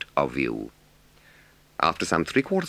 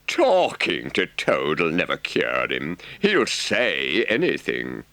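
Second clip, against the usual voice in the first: contemptuous rat; slower than usual. A narrator's reading. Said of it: "said the rat contemptuously".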